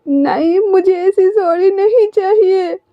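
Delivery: high, slow tearful refusal; crying. A narrator's reading. Wing refusing Wangji's sorry, high and tearful. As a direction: wail it out like a sulking child. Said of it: "wing कहता है रोते हुए".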